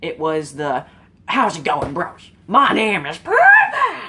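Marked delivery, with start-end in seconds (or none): 1.2-4.0 casual greeting building to a high, loud laughing shout; mock his hyped-up channel intro, ending in a yelling laugh; imitating a YouTuber's high-energy opener, cranked higher and louder at the end; PewDiePie intro impression. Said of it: "that high energy thing that he had to do".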